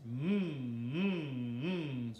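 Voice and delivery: hummed sirens swooping up and down; humming voice revs. Shown as a demonstration. Teacher revs up the voice for singers, humming up and down. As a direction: hum in loud rising and falling waves. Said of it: "we're gonna rev up the voice".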